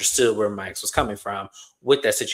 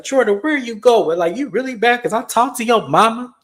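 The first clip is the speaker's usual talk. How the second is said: high-pitched, louder mock protest; upset girlfriend impression; 11 semitones higher, 5 dB louder than usual. Retold girlfriend upset at boyfriend leaving.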